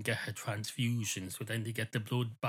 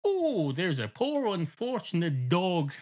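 higher, slow mock-eager gush; mock excitement; 7 semitones higher, 3 dB louder than usual. Dog mocking the narrator's eager pity, hammed-up excitement. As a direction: gush over the poor dog like a do-gooder. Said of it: "all excited".

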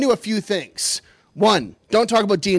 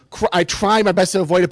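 ordinary talk, nothing marked

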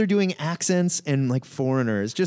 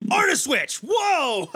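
high-pitched startled shout; hammed-up cry of shock; mock alarm; 9 semitones higher, slower than usual. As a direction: shout it like a sudden jolt. Mimicking jarring art change in comic.

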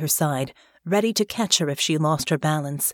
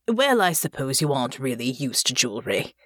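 put-on older father voice; 4 semitones lower than usual.

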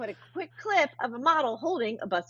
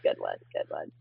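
whisper; 8 dB quieter than usual. Retold or imitated. Mock self-praise quoted.

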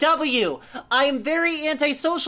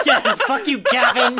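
laughing mock curse; 6 dB louder than usual. Real, not acted. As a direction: laugh through a louder curse. Laughing curse at story's friend.

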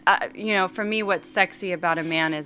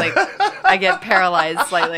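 laughing; 6 dB louder than usual. Real, not acted. Laughing about Tom Brady.

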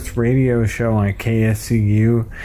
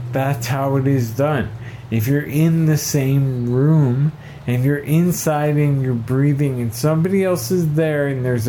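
bright, higher promo voice; radio ad read; 4 semitones higher than usual. Acted. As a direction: sell it like a mock PSA. Reads second fundraiser script.

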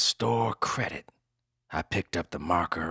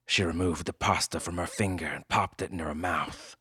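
usual speaking voice

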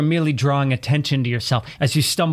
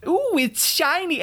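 mock dazzled voice; 11 semitones higher, slower than usual. Mocking glitter fans.

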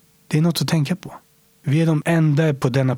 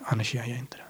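very soft unvoiced whisper; whispered ending; 11 dB quieter, faster than usual. Real, not acted. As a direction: let it fade to a whisper. Final firm statement.

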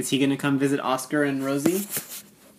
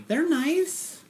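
high voice; 13 semitones higher, 3 dB quieter than usual. Acted. Parent in high voice.

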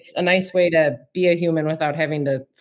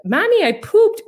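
put-on very high, louder toddler announcement; shrieky kid impression, pitched way up; mock child's scream; 6 dB louder than usual. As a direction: imitate a small child yelling to mommy across the house. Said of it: "she'll just keep screaming that she pooped".

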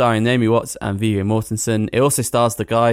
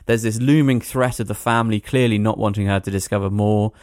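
normal talking voice

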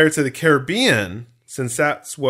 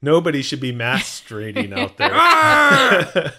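laughing; 2 semitones higher, 5 dB louder, slower than usual. Real, not acted. Joking about misheard word.

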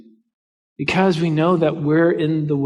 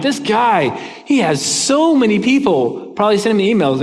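higher-pitched, impressed marvelling; retold amazement; 6 semitones higher, 3 dB louder than usual. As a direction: marvel aloud at a big shot. Marvels at the busy man replying.

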